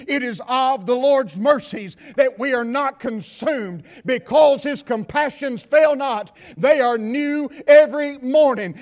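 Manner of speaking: half-sung preaching; preaching scripture. Acted. Preacher intoning Lamentations to the church, half-sung. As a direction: lift the verse into a chant.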